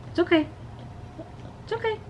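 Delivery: soft, high reassurance; calm her gently; soothing a dog. Real, not acted. Soothing dog during nail trim.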